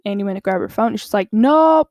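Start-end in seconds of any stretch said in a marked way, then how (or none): 1.3-1.9 a sharp, louder, higher "Nope". Retold or imitated. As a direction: shut him down fast with one loud no. Brooklyn guards her phone.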